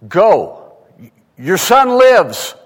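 high, loud, slow proclamation of the words; commanding Jesus. Acted. Preacher booms Jesus' words to the father, slow and loud. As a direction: ring out each word like a divine command. Who says to somebody.

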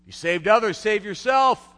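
jeering thief yelling at Jesus; mocking jeer. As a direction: sneer it up at the cross. Thieves mocking Jesus on cross.

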